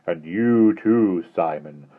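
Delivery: insidious. Acted. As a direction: coax them with a creeping threat, drawn out. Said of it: "gentle, insidious".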